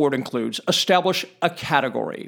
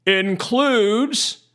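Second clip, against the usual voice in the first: includes set apart by pauses, higher and drawn out; stress on includes; 4 semitones higher than usual. Shown as a demonstration. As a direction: hit the word includes slowly and deliberately, pitched up. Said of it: "I'm going to emphasize the word includes".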